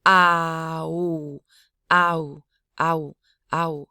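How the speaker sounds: "ow" as a quick a-u glide, throat closed only at the start; Hmong diphthong. Shown as a demonstration. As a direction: glide "ow" from glottal a into open u. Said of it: "you drop the glottalization on the second".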